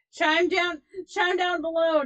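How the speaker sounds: loud, high announcer-like call; calling out to viewers. Real, not acted. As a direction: call it out loudly to the audience. Calling on audience to comment.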